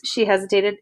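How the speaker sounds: plain reading voice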